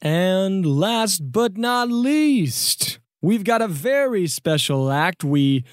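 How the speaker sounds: grand talent-show emcee; stage announcer. Acted. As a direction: introduce the big final act with stretched words. Announces the final act.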